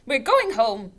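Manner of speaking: high, loud furious command; angry mother ordering her son home. A narrator's reading. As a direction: call the boy away sharply. Widow storms off with son.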